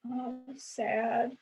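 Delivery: put-on high, soft small-child reply; little toddler voice. Mom imitating her toddler's sad answer in a high little voice.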